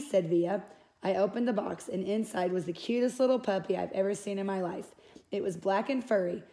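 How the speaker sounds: plain reading voice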